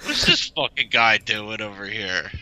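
lightly hammed-up drunken slur; imitating drunk friend. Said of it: "when you drink, your voice changes".

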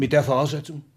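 loud mocking echo of "Mit der Voraussetzung"; scornful echo. Exzellenz mockingly repeats the secretary's phrase, loud. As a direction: throw his words back at him.

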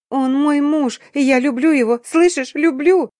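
high, impassioned crying plea; tearful outburst. A narrator's reading. Weeping girl tells the boss she loves her husband, high and frantic. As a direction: cry it out high, drawing out the last words. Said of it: "затараторила".

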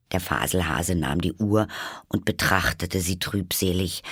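ordinary delivery

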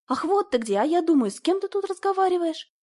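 high bright excited voice; light up with delight. A narrator's reading. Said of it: "обрадовалась".

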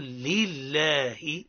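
very slow لله with a clear kasra and a long lam; drawn-out model. Shown as a demonstration. Teacher gives the class لله to repeat. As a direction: stretch لله out for them to repeat. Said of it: "قل".

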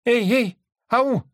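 calling out. A narrator's reading. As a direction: call out high and loud. Sister calls out.

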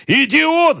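very high, loud insult; furious yell. A narrator's reading. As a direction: scream it at a disobedient subordinate. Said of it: "закричал Муравьёв". (яростный крик на высокой ноте)

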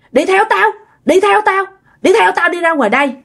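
put-on high, insistent command quoted by a child; child mimicking the woman, pitch up; menacing woman's order. Child imitates the strange woman ordering them to follow, insistent. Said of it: "bả mới kêu rằng á là".

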